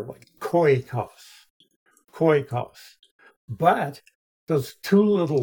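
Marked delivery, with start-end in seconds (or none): 0.0-3.0 "koikos" with the o-i run together as one sound; koikos as diphthong. Shown as a demonstration. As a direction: say "koikos" in two syllables, KOY-kos. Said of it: "you pronounce them as one letter".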